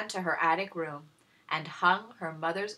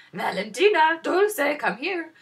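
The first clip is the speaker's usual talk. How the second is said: higher, louder call to daughters; 11 semitones higher, 10 dB louder than usual. Acted. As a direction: summon the girls from the next room. Stepmother calling daughters.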